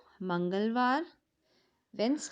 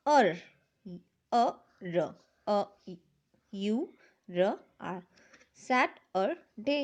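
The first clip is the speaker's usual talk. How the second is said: syllable by syllable; 3 semitones lower, much slower than usual. Shown as a demonstration. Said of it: "phonics sounding out".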